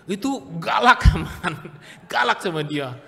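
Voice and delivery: laughing through the words. Real, not acted. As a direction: chuckle it out. Laughing confession.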